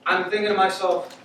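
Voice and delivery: usual speaking voice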